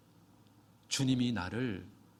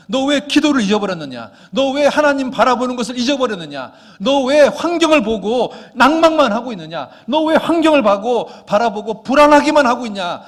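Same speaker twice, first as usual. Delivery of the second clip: high, loud scolding of his own soul; David shouting down his own despair; self-rebuke shout; 9 semitones higher, 16 dB louder than usual. Acted. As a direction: bellow at yourself to wake up. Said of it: "자기 스스로에게 말하는 겁니다".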